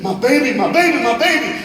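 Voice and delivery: women screaming for their babies; wailing mothers. A narrator's reading. Mothers screaming amid the rubble.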